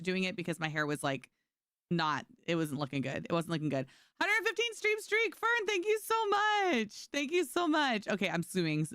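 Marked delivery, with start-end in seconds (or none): 4.2-8.1 gushing, high-pitched thank-you; high, excited streamer shout-out. Real, not acted. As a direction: squeal your thanks, drawing out the praise.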